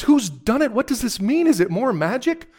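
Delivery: high, loud, urgent questions; startled Susan. A narrator's reading. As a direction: cry out the questions in astonishment.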